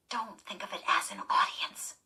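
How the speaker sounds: whispered self-encouragement. Acted. Girl backstage whispering to calm herself. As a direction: hush it to yourself.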